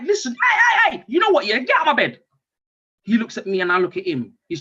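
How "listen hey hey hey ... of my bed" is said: high, re-enacted telling-off; angry shout. Pissed-off dad ordering his child out of bed, shouting. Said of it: "I had to shout at him".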